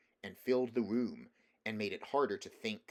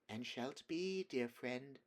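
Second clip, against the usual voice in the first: put-on soft, higher, like to a child; soothing, humoring; 4 semitones higher, 4 dB quieter, slower than usual. A narrator's reading. Witch humoring the prince like a child, soothing. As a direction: humor him gently. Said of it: "in a soothing voice, as if she was humoring a child".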